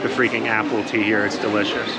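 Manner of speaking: ordinary talk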